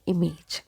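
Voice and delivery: "image" with a long A in the second syllable; mispronounced "image". Shown as a demonstration. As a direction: say "image" as im-AYJ. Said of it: "many people pronounce it like".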